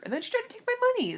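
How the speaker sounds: very high, fast, loud defence; high-pitched hurried self-justification; squeaky protest. Real, not acted. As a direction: rush out an indignant squeal.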